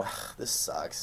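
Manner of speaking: slow, hushed groan of displeasure; low-volume, dragged-out complaint; muttered disgust. Retold or imitated. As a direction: grumble it under your breath. Quotes own disgusted reaction.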